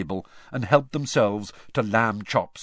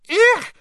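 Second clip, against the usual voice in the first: shrill little squeal, much higher and louder; high squeal of disgust; 12 dB louder than usual. A narrator's reading. Girl recoiling from slimy crates squeals in disgust. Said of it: "squealed Lavender Brown".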